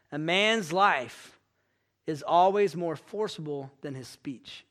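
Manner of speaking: slow, measured quotation; quotation reading. A narrator's reading. Preacher quoting Spurgeon to the congregation, slow and measured. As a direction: read it out slowly and weightily, phrase by phrase.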